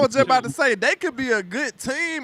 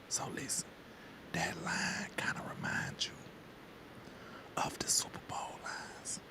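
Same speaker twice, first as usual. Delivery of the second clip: whisper; 18 dB quieter than usual. Pretend. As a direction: whisper it so the universe can't hear. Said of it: "We gonna whisper this".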